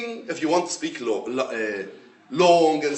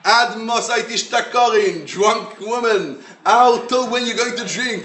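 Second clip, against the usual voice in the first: put-on slow, louder scolding of a drunk woman; stern rebuke; 5 semitones higher, 4 dB louder, slower than usual. Priest scolds praying woman he thinks drunk, louder stern rebuke. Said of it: "what Eli HaKohen tells her".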